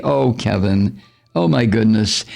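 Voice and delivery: usual speaking voice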